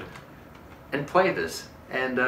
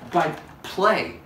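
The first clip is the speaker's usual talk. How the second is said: ordinary talk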